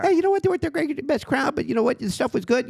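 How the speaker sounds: high mock reassurance from the host; imagined Jay consoling. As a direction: pretend Jay kindly cheering him up. What Jay should have said.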